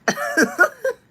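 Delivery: high, loud laugh at the absurdity; loud burst of laughter. Real, not acted. Recalling the stranger crying at her, bursting into loud laughter.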